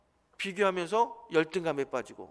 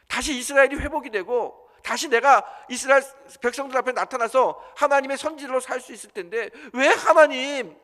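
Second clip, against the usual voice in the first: loud, higher indignant protest at God; complaining to God; 5 semitones higher, 8 dB louder than usual. Acted. Elijah protesting to God, loud and resentful. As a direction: hurl the complaint upward, pleading and accusing. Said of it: "하나님이 원망되는 거예요".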